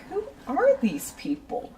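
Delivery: whispered, baffled aside; bewildered whisper. Retold or imitated. Muttering to herself about two bragging customers, whispered in disbelief.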